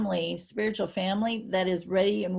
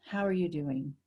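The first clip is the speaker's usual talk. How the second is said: deflated greeting; 4 semitones lower, 6 dB quieter than usual. Retold or imitated. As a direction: lower and softer, all the energy dropped. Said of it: "I just completely drop that energy".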